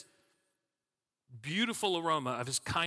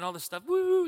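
much higher, louder happy woo; excited whoop; 13 semitones higher, 8 dB louder than usual. Retold or imitated. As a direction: let the woo fly up with joy. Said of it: "we're like".

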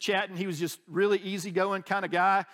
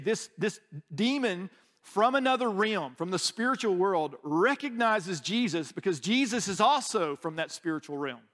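ordinary talk, nothing marked

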